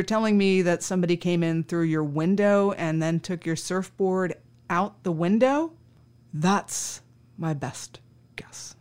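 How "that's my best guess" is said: slow hushed whisper; whispered answer. Retold or imitated. Officer imitating the stoner's guess about the burglar, slow whisper. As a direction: breathe it out slowly and secretively.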